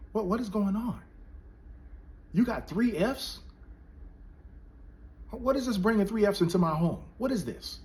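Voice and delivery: high, stern father scolding his son; imitating an angry dad, high pitched. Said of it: "my dad got angry".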